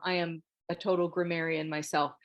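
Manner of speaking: usual speaking voice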